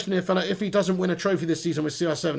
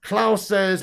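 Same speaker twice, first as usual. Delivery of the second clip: usual speaking voice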